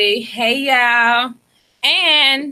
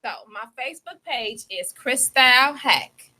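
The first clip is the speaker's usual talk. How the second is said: ordinary talk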